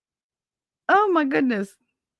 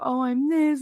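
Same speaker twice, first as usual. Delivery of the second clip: mocking; 7 semitones higher than usual.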